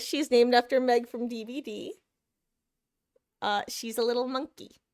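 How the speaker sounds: ordinary talk, nothing marked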